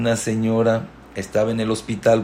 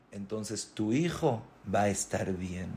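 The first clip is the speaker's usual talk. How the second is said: much softer, calm assurance; quiet promise; 3 semitones lower, 12 dB quieter than usual. Acted. Sage quietly promising the mother her son will heal. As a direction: promise it gently.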